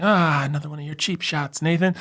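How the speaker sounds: high, put-on exasperation; mimicked scolding. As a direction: parody friends complaining about you. Mimics friends scolding him.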